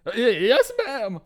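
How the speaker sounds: high, loud stammered reply; stumbling, jumpy acknowledgement; flustered pupil. Acted. Pupil scolded by sword instructor, flustered stammer.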